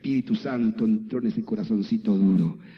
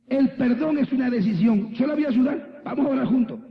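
urgent urging; 12 semitones higher, 3 dB louder than usual. Retold or imitated. Pastor urges woman to pray.

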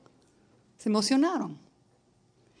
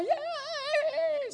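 crowd cheer; 6 dB quieter than usual. Retold or imitated. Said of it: "se quitaban el suéter y hacían".

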